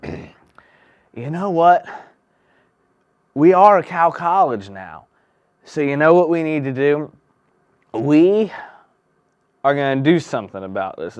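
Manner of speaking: put-on old man's bold decree. Old man announces plan.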